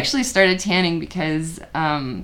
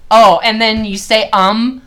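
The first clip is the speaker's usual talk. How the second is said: raised, loud exasperated threat; mock angry scolding; 4 semitones higher, 7 dB louder than usual. Comic threatens their taped self over a filler word, mock angry. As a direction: warn with comic outrage. Keeps berating own recorded self.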